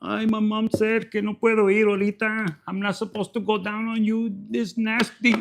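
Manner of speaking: whiny mock mama's boy voice. Mama's boy refusing because mom said no, put-on voice. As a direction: put on a sheepish momma's boy. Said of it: "mama's boy".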